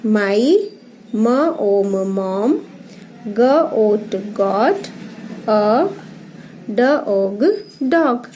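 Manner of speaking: slow teacher reading by letter sounds; phonics reading. Shown as a demonstration. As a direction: sound out each word, then blend it. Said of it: "ये paragraph read करेंगे". (ध्वनि जोड़-जोड़कर पढ़ना)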